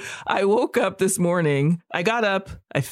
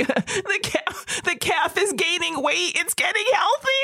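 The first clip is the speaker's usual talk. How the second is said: teary gushing; 12 semitones higher than usual. Retold or imitated. Woman overwhelmed by calf photos, high teary gushing. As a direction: gush over the calf like you're about to cry. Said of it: "I'm like".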